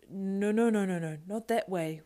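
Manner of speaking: wailing. Acted. Schmiegel protesting the plan, wailing. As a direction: wail the protest. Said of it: "wailed Schmiegel".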